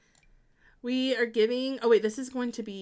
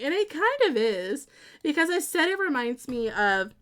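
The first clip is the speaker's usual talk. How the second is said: normal talking voice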